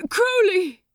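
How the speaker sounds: high, loud call across the deck. Acted. Angel calling to Crowley across the deck, loud. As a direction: call his name urgently. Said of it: "he called".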